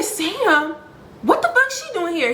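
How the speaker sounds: high, incredulous retold reaction; shocked disbelief. Storyteller replays learning Sam has turned up, shocked disbelief. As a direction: replay being thrown by the news, pitched high.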